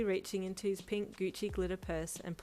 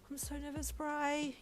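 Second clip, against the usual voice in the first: higher but softer nervous wail; 9 semitones higher than usual. Acted. Nervous bride to her friend, soft wail. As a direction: whimper it out in a panic. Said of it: "you wailed softly".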